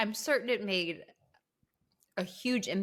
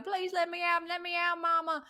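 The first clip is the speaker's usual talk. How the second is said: high imitation of a locked-in girl begging; crying Carrie; 10 semitones higher than usual. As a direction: plead high to be let out, like a trapped child. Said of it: "Carrie's crying".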